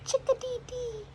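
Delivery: very high chirped call; chickadee chirp. Retold or imitated. Narrator voices the chickadee's call, high chirp. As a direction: chirp it like a small bird. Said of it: "The chickadee chirps".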